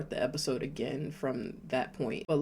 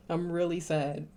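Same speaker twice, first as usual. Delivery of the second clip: ordinary talk, nothing marked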